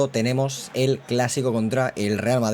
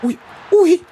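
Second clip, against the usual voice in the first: high, loud yelps; startled; 7 dB louder, slower than usual. Real, not acted. Watching Lamine's shot nearly go in, high yelps. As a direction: cry out at the close chance. Near miss, sudden cry.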